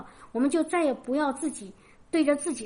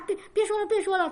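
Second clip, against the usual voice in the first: a mild imitation of an irritated son, higher; annoyed son; 7 semitones higher, faster than usual. Mother imitates her fed-up son telling her to stop, mildly annoyed. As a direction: brush her off, pitch raised, half-mocking. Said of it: "他很烦啊".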